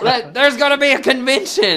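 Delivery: playful mock fan excitement; hammed-up teasing excitement. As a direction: keep teasing the kid with laughing fake thrill. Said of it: "I was like".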